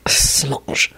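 soft, breath-only whisper of a name; lovestruck murmur. A narrator's reading. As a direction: sigh her name softly. Man sighs his lover's name.

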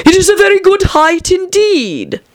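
very high, indignant caterpillar voice; angry caterpillar. A narrator's reading. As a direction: protest in offended outrage, pitch soaring. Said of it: "said the caterpillar angrily".

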